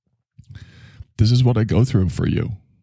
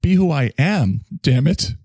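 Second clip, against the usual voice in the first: manic declaration; 8 semitones higher than usual. Acted. High excited self-pep rant.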